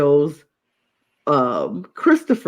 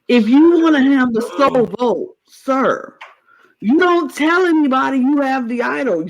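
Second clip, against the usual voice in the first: loud, higher lecture to the player; mock scolding; 6 semitones higher, 4 dB louder than usual. Fan lecturing the player directly, loud exasperated scolding. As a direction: scold him like he's in front of you.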